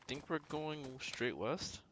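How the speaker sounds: usual speaking voice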